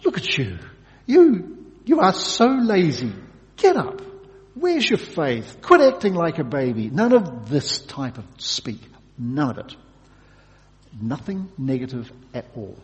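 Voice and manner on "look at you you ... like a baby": loud, high, scornful telling-off; a booming angel berating a worn-out prophet; harsh scolding rebuke. Pretend. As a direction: scold him like a lazy child, loudly. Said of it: "saying things like".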